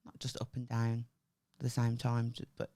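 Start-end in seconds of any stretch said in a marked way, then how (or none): none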